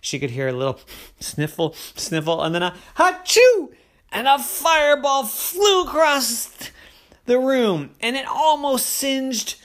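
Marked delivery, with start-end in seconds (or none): 3.0-3.7 big hammed-up sneeze, high and loud; mock sneeze. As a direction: sneeze it out like a dragon. Dragon sneezes fireball.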